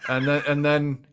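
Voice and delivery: normal talking voice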